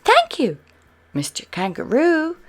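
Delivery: high, loud call; shouting. Acted. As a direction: call out across the wind. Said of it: "shouted Spot".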